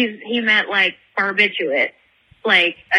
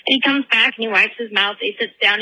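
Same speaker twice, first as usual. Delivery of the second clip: usual speaking voice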